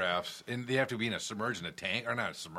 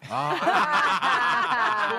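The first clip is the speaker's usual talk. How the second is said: high, slow, laughing dodge; 14 semitones higher, 8 dB louder, much slower than usual. Real, not acted. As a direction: laugh off the question. Laughing embarrassed answer.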